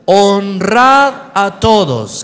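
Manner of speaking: "Honrad" fuerte y muy lento; lectura fuerte. Shown as a demonstration. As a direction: lee fuerte con la congregación. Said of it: "Vamos, fuerte". (manda a la iglesia a leer fuerte, lento)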